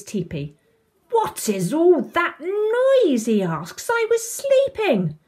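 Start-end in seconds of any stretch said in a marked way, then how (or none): 1.1-3.3 high, loud, stretched-out grumble; indignant complaint. A narrator's reading. Tom woken in his teepee by noise, indignant. 3.9-5.2 high, loud, stretched-out grumble; indignant complaint. A narrator's reading. Tom woken in his teepee by noise, indignant.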